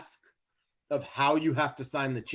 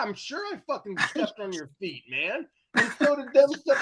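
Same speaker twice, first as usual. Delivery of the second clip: high-pitched protest to the big guy who wants to fight; high incredulous plea; 10 semitones higher than usual. Retold or imitated. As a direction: voice jumps way up, half laughing in disbelief. Retold plea to angry clubgoer.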